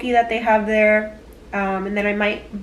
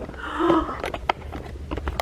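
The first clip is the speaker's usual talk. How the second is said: soft gasp of wonder; 8 semitones higher, 6 dB quieter than usual. Real, not acted. Sees a rainbow.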